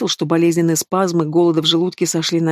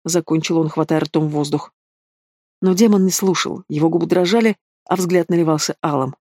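ordinary delivery, nothing marked

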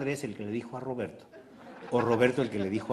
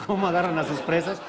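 usual speaking voice

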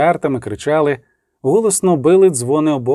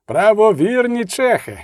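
slow, higher call to the army; shouted address; 6 semitones higher, slower than usual. A narrator's reading. Preacher calling out to his army, slow and higher. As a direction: call the crowd to listen. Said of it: "закричав він".

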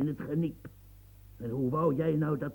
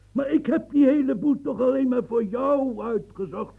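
higher and louder, wounded protest; indignant outburst; 8 semitones higher, 7 dB louder than usual. Acted. Old man protests loudly to the officer, indignant. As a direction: fling it back, hurt and furious.

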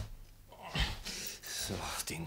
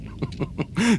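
airy incredulous laugh; 13 semitones higher, 5 dB louder than usual. Acted. Laughing off the dream.

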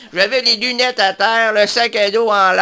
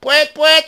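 silly horn honk; 5 semitones higher than usual. Pretend. Honking like a bus horn.

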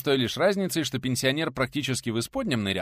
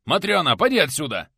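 raised, loud scolding order; sharp shout; 7 semitones higher, 10 dB louder than usual. A narrator's reading. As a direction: shout the girl out of the room. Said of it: "Прикрикнул он на притихшую в углу девушку".

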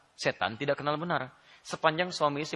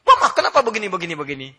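mock angry husband; 5 semitones higher, 11 dB louder than usual. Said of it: "Suami marah-marah".

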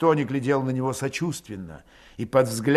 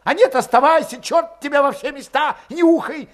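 high, loud, testy outburst; sudden angry snarl; 13 semitones higher, 7 dB louder than usual. A narrator's reading. Valet turning on his doubtful comrade, snarling. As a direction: snap back in a high, irritated voice. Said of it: "окрысился вдруг Валет".

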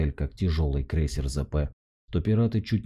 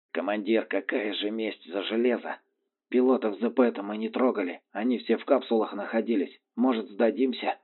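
higher, reluctant pilot voice; grumbling pilots; 6 semitones higher than usual. A narrator's reading. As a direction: grumble and plead to surrender. Pilots grumble and want surrender.

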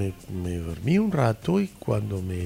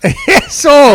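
laughing exclamation; 14 semitones higher, 14 dB louder than usual. Real, not acted. Finally recalling the word plañidera.

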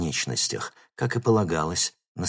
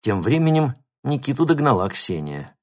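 usual speaking voice

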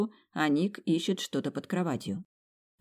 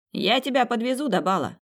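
higher, louder call across a distance; shouted after her; 7 semitones higher, 4 dB louder than usual. Acted. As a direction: yell it after someone walking away. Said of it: "крикнул мне вдогонку Этан".

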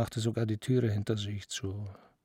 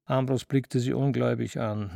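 plain reading voice

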